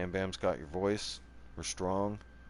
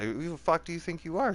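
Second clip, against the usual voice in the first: higher and a bit louder, jabbing the question at her; retold furious retort; 9 semitones higher, 4 dB louder than usual. Retelling his retort to the family judge, higher angry challenge. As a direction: throw it back at the judge, rising with outrage.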